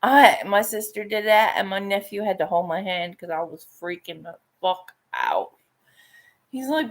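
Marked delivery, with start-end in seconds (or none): none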